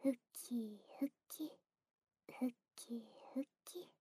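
dwindling playful whisper; fading whisper. Acted. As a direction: let each word fade away quietly.

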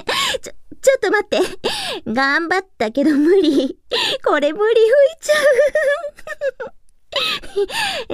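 cracking up mid-line; can't hold back the giggles; breaking into laughter. Real, not acted.

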